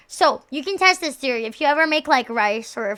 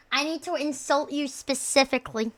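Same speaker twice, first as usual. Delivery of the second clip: usual speaking voice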